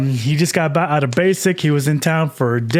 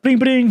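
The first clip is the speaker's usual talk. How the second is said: high, quick mock ringtone; phone ring imitation; 9 semitones higher than usual. Telling how the phone blows up, high imitated ringing.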